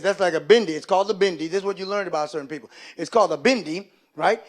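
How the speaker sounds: normal talking voice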